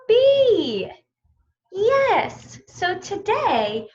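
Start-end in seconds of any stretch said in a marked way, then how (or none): none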